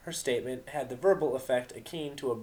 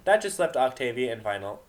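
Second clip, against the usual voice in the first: normal talking voice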